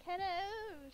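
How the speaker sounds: high, loud, drawn-out hello; flirty greeting. Acted. Player greets the barman in character, high sing-out hello. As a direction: call out a playful, lifted greeting. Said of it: "so I could be like".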